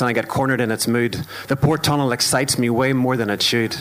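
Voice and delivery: steady rhythmic poetry performance; spoken word poem. Acted. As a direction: perform it like a stage poem, measured and deliberate.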